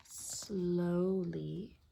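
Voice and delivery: drawn-out whisper, very soft; slow and hushed. A narrator's reading. Storyteller echoing the peddler's slow walk, hushed and slow. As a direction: stretch "slowly" out in a whisper. Slowness acted out, hushed repeat.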